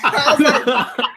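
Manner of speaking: high-pitched telling broken by laughter; laughing while telling. Real, not acted. Friend retells an embarrassing phone call, laughing. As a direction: giggle through the retelling.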